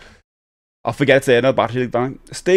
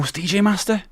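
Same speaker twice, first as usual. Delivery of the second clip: ordinary talk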